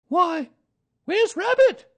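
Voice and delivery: very high, loud mother kangaroo; high-pitched puzzled mother animal; Kanga's voice. A narrator's reading. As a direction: ask in a high, loud character voice.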